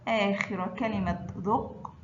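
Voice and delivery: normal talking voice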